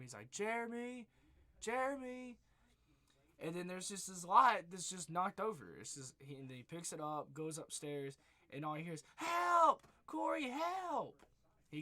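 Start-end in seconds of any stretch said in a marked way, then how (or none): 0.3-2.4 high, raised call of a name. Retold or imitated. Babysitter calling through the house for Jeremy, raised call. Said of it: "He starts calling for Jeremy". 9.1-11.2 high, loud scream, slow on the second cry; screaming for help. Retold or imitated. Kid crying out to the babysitter for help, loud scream.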